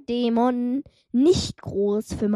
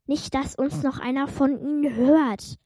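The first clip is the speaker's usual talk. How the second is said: normal talking voice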